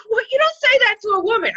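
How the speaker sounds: high, loud outraged yelp; indignant shout. Retold or imitated. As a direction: fire back, scandalised and loud. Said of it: "I'm like".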